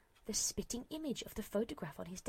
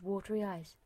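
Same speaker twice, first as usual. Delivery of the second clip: ordinary delivery, nothing marked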